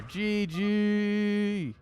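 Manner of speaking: high, drawn-out GG whoop; excited game-over call. Real, not acted. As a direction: sing it out high as the match ends. Caster calls end of the game.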